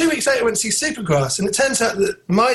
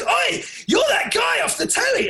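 high mock shout of a fan; imitating a shouting fan. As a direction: yell like a fan spotting a celebrity. Fan shouting in toilet queue.

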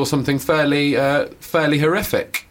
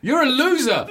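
high, hammed-up scorn; mock disappointment; 12 semitones higher than usual. Imagined singer disowning the nosebleed fan, mock scornful. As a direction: jeer in a high put-on voice. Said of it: "He's really disappointed".

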